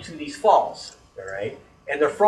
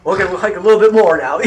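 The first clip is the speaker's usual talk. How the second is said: laughing, louder; 5 semitones higher, 10 dB louder, faster than usual. Retold or imitated. Eager to keep hunting Sasquatch.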